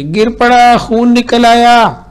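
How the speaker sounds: high, loud, slow shout. A narrator's reading. Child shouting to his mother that he fell. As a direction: shout it slowly across the house, high. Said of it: "जोर से".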